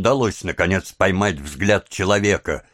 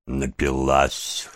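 low, slow, detached verdict; note it coolly to yourself, drawn out and unmoved; cold-blooded; 4 semitones lower, slower than usual. A narrator's reading. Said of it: "хладнокровно подумал еврей".

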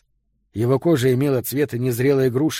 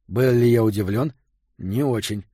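usual speaking voice

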